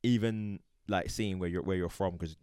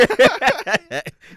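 loud high laughter; loud high-pitched laugh; 12 dB louder than usual. Real, not acted. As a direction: burst out laughing. Laughs at reply.

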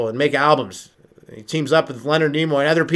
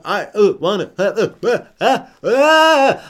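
rising, louder stammer to a strained peak; dying Shatner stammer; 6 semitones higher, 4 dB louder, slower than usual. Retold or imitated. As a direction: stammer as if fading out. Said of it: "it sounds like he's dying".

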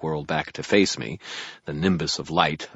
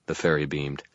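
ordinary delivery

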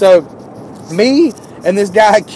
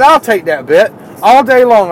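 louder, higher, defiant retort; heated comeback; 5 semitones higher, 5 dB louder than usual. Retold or imitated. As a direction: fire it back, he's daring you. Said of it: "Man, I was hot".

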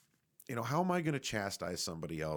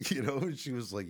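ordinary delivery

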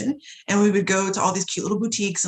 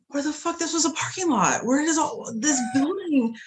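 bewildered; 7 semitones higher than usual. Retold or imitated. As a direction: act out being lost and baffled. Retelling her own bewildered reaction.

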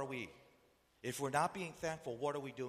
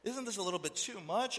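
mildly shocked protest, pitched up; taken-aback listener; 5 semitones higher than usual. Acted. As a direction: question God in mock dismay. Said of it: "You're hearing that, you're like".

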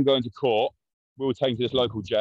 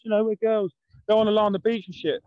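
put-on much higher whiny teenage plea; mock girly sister; 11 semitones higher than usual. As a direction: mimic the sisters, pitched way up. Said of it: "Sister's like".

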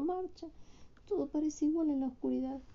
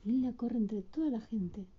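ordinary delivery

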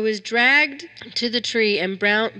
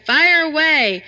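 much higher, louder, slow shout; 7 semitones higher, 10 dB louder, slower than usual. A narrator's reading. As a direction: bellow the command to light the fire. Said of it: "A voice shouted".

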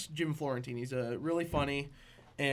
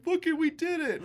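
high, mock-proud little cheer; imitated glee; 12 semitones higher, 3 dB louder than usual. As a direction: imitate a losing team thrilled with itself. Quotes the happy losing team.

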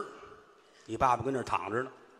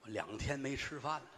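feeble hungry son; 4 semitones lower, 13 dB quieter than usual. Retold or imitated. Starving brother answers weakly.

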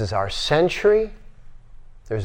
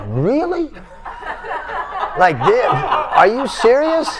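much higher, incredulous with a laugh; mock disbelief; 10 semitones higher than usual. People in the room reacting to the teacher's admission, amused disbelief. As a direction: act the crowd's astonishment.

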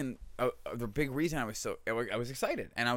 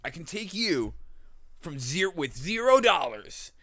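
infomercial guru; 5 semitones higher, 8 dB louder than usual. Retold or imitated. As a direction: hype up zero dollars to millions. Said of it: "one of those videos where it's like".

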